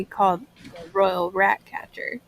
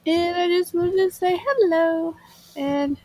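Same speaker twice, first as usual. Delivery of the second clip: sleepy, just-woke-up voice; groggy; 9 semitones higher than usual. Real, not acted. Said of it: "excuse me if I sound a little groggy".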